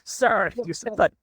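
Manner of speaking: high-pitched, hammed-up indignation; mock outrage. Parodying a polite telling-off of a swearing client, mock outrage. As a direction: scold with exaggerated politeness.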